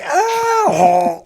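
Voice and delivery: a short high yelp, choked off mid-scream; a sharp high cry that stops dead; cut-off scream. Acted. Impaled soldier's scream, cut short by a spike.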